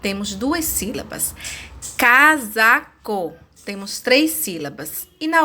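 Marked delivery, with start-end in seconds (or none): 2.0-3.3 CASACO slowly split as ca-sa-co; measured, pause-divided Casaco; syllable by syllable. Shown as a demonstration. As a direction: break Casaco into three separate beats. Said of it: "Podemos dividir as palavras em sílabas".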